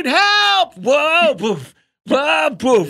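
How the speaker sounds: high, loud cartoon cry for help; George Jetson shouting. Cartoon husband calling his wife from a runaway treadmill, comic shouting. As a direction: yell it in comic panic, vowels stretched. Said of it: "He's call-, he's shouting".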